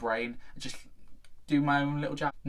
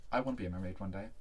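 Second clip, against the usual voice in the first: ordinary talk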